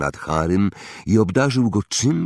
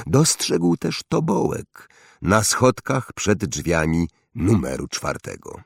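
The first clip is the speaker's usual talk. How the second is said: usual speaking voice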